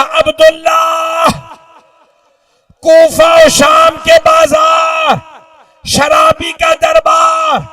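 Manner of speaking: high, slow shouted wail; full-force tearful lament; crying out in grief. Acted. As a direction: hurl out each phrase, stretched and anguished. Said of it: "रो के कहा".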